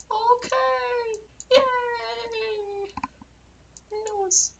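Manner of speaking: very high cartoon voice cheering; cartoon elephant cheer. Giant elephant cheering in a very high cartoon voice. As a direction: pipe it up high like a toy.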